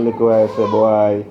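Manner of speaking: ordinary talk, nothing marked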